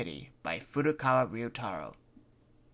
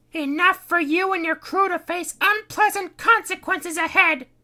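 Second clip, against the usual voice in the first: much higher sneering threat; 11 dB louder than usual. Acted. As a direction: look down on them as you warn. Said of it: "she sneered".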